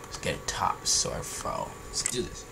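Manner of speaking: ordinary delivery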